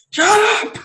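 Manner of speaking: very high screeched shut up; screamed shut up. Retold or imitated. Retelling the killer's scream, high screech. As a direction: screech it at the top of the voice. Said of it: "She starts screaming".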